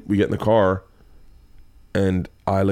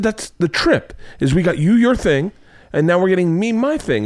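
higher, punched-out mock lecture; exasperated dad; 8 semitones higher than usual. Dad spelling out the trip to daughter, exasperated. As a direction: lay it out like a fed-up dad.

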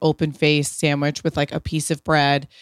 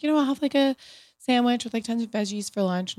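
lightly raised, casual voice for the guest; breezy reply, higher than her own; quoting a guest; 7 semitones higher, 4 dB quieter, faster than usual. Retold or imitated. Retelling what a podcast guest ate for lunch, lightly voiced.

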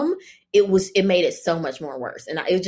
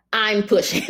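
laughing defiance; 4 semitones higher, 4 dB louder than usual. Retold or imitated.

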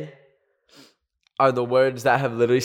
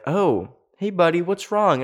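nonchalant counselor; 6 semitones higher than usual. Retold or imitated. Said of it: "very nonchalant about it".

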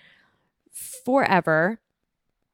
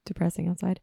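mock self-pity. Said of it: "throwing myself a pity party".

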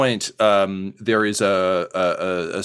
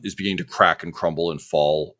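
ordinary talk, nothing marked

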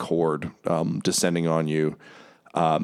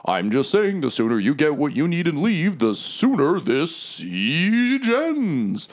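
Mahadi voice; 9 semitones higher than usual. Acted. Mahadi annoyed.

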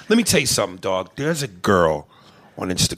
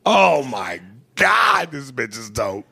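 swooning outburst; slower than usual. Real, not acted. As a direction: cry it out slowly, overcome with admiration. Raving over the woman's accent.

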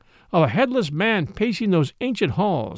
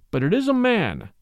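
higher, a touch of surprise; mild surprise; 4 semitones higher than usual. A narrator's reading. Craven finds a man in the coffin, mildly surprised. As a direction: raise the pitch at an unexpected find. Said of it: "as if that were something unexpected".

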